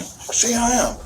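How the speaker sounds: short high tearful cry; retold tearful challenge; imitated crying. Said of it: "he's, like, crying".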